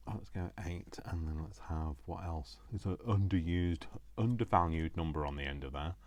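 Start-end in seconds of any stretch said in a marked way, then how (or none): none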